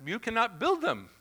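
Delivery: hammed-up French accent like Clouseau. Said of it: "And they have this French accent".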